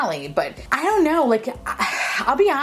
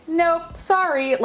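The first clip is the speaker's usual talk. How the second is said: higher and slow, drawn-out mock brush-off; dismissive refusal; 9 semitones higher, much slower than usual. Staring the unused palette in the eye, slow mock brush-off. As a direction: turn the palette down like a bouncer. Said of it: "I'm like".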